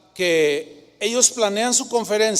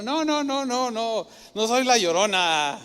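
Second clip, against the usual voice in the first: humorous Jesus; 3 semitones higher than usual. Retold or imitated. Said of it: "Yo me imagino hasta con cierto humor".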